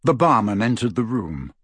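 ordinary delivery, nothing marked

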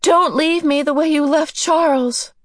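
high-pitched desperate cry; pleading, high outburst; shouting. A narrator's reading. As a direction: shout it in terror at your father. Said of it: "Meg shouted at her father".

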